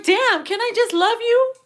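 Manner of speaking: high-pitched mock protest; exasperated plea. Partner pleading with the one who suspects them, exasperated.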